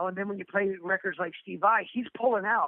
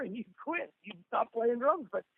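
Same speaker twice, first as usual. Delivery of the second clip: ordinary delivery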